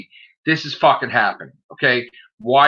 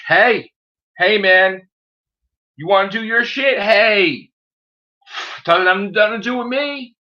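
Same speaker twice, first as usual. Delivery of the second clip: higher, raised-voice "not my business"; retold brush-off; 6 semitones higher than usual. Telling viewers how they brushed him off, high dismissive retelling. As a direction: wave them off in a high, lifted voice.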